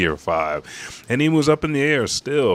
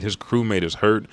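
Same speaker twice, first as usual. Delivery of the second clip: usual speaking voice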